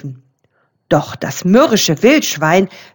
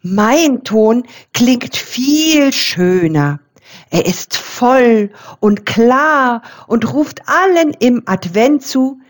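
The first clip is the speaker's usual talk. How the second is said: a touch higher, ringing bell voice; middle bell; 4 semitones higher, much slower than usual. A narrator's reading. The middle bell boasts of her tone, ringing brightly. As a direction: sing out each Liebe like a bell. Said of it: "tönte die mittlere gusseiserne Glocke".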